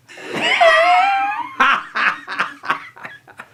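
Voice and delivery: a high, loud burst of laughter; loud shrieking laugh. Real, not acted. Husband cracking up while trying to record. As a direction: crack up loudly.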